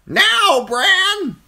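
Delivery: high, loud crow voice pressing hard; urging crow. A narrator's reading. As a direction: push him like an impatient bird. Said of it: "the crow urged".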